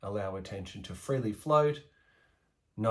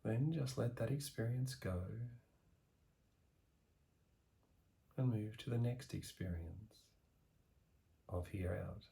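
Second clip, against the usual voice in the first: guided meditation; 5 dB quieter than usual. Acted. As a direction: ease the listener into calm, letting the voice sink softer. Guided meditation practice begins.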